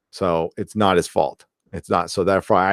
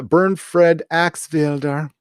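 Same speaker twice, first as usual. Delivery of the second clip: put-on slow, deliberate German accent on the name; mock German accent, drawn out; 6 semitones higher, much slower than usual. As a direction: savor "Bernfred Axfelder" slowly in a playful German accent. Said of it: "I just like saying it in a German accent".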